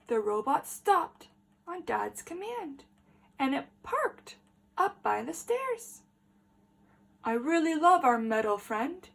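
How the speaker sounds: put-on lively read-aloud for small children; story reading. A narrator's reading. Teacher reading a picture book to the class, lively.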